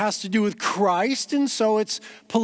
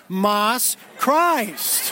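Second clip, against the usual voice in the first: "Mas Christ" slowly, each word separate; emphatic Mas Christ. Shown as a demonstration. Preacher explaining Christmas as more Christ, emphatic "Mas Christ". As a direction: land hard on a drawn-out "Christ" after "Mas". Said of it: "Christ mas is Spanish for more Christ".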